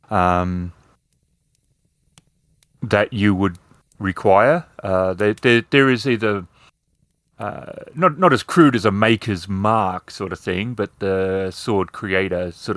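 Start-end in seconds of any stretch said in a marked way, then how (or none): none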